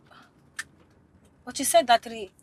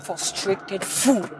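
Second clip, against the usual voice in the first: slow, low, softer insult; bitter grumble; 8 semitones lower, 11 dB quieter, much slower than usual. Acted. Passenger insults the driver as the quarrel ends, bitter grumbling. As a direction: grumble it under your breath.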